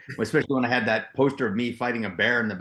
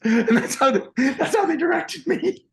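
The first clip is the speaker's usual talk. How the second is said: laughing; 5 dB louder than usual. Real, not acted. Actor laughing while telling how the directors worked, words giggling. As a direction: talk through real laughter, much higher and louder.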